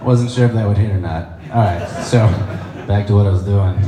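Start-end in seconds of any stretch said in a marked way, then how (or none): none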